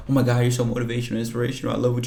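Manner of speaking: fast, overeager gushing parody; mock gushing fan. Streamer parodying a fawning reply, fast mock gushing. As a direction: rattle it off like a fawning sucker. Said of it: "it ain't even about, like".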